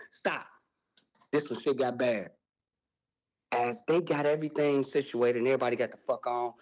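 ordinary talk, nothing marked

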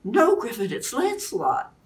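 cry out. A narrator's reading. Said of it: "Guinevere cried out".

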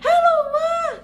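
put-on high, loud childlike greeting; twin's phone greeting. Twin greets mom on the phone, high childish voice. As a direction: squeak it up like a little kid on the phone. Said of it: "Si kembar aku telepon".

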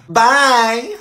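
usual speaking voice

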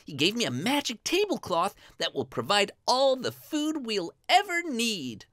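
put-on high, eager boy voice; Lad, excited. Lad tells his mother about the gift, high and excited. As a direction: burst out with good news to mother.

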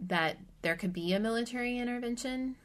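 ordinary delivery

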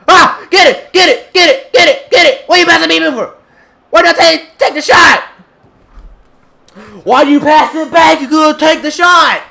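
high, loud yelling at teammates; shouting high and loud into the mic; angry gamer shouting. Real, not acted. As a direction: scream at the screen in frustration. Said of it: "I'm sorry for all the headphone users".

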